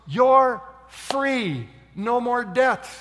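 loud, high declaration of release; jubilant proclamation. Acted. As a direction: proclaim the pardon to everyone. King proclaims the debtor free.

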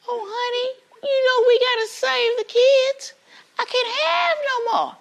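very high, wailing Southern belle. Acted. Wife pleading to save the kids, Southern belle wail. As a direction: play a hammed-up, fretful Southern lady. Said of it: "typical Southern belle".

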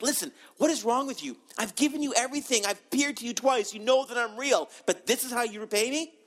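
high, pleading rebuke as God; exasperated God. Acted.

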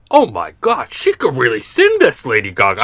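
high, excited mock dad voice; amazed American dads. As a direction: gush like a dad who has just been blown away. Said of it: "all the dads in America went".

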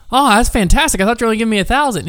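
high-pitched mock granny, delighted; lightly hammed-up grandma voice; imitated old grandma. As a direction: put on a high old-lady voice. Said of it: "sounded like an old grandma".

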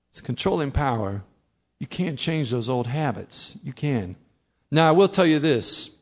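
usual speaking voice

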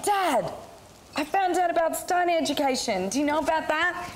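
high-pitched, eager daughter on the phone; excited announcement. Retold or imitated. Retold eager call to dad.

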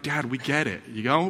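put-on a tired teenager brushing dad off; bored kid voice. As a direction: kids groaning at dad's old stories. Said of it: "They're like".

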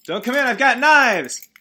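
high, loud panicky yell; mock-frantic warning holler; warning shout. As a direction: shout a threat through the door. Warns off whoever is outside.

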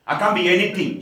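normal talking voice

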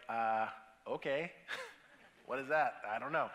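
low, offhand mumble of "What is that? I don't know."; shrugging it off. Retold or imitated. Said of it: "most of us just kinda go like".

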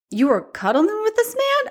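mock disbelief, pitch soaring to the end; incredulous husband. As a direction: imitate a baffled husband's high-rising question. Acts out husband's incredulous question.

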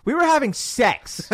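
high, loud, matter-of-fact mom; blunt mom. Retold or imitated. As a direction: say it straight to the kid. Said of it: "straight out looks at him and goes".

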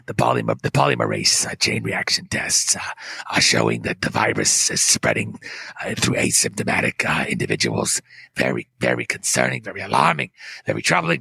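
detached and boring, full of hesitations; low droning official with long uhs; Fauci impression. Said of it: "He says".

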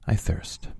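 hushed, unvoiced utterance; weak whisper. A narrator's reading. Jesus on the cross, near the end, whispering. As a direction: let it out under the breath.

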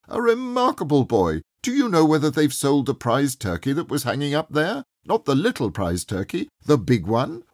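high, lively questions; eager old man asking a boy about the turkey. A narrator's reading. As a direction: press the boy with glee. Scrooge eagerly quizzing the boy.

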